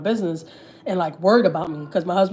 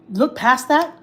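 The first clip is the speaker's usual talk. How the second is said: higher and louder, exasperated; heated; 6 semitones higher, 5 dB louder, much slower than usual. Real, not acted. Confronting her in-laws, exasperated and heated. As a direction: push it up, fed up.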